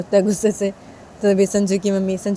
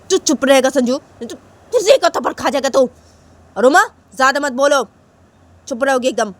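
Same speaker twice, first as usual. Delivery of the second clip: loud, raised-pitch warning; acted cross mother; stern scolding; 6 semitones higher, 5 dB louder than usual. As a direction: silence both children with a threat. Mother scolds, threatens slap.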